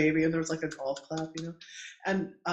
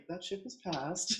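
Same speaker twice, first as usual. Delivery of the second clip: soft, slow deadpan aside; wry dismissal; 2 semitones higher, 9 dB quieter than usual. Retold or imitated. Doula answering her client's squatting question, wry aside. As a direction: let it drop quietly and slowly.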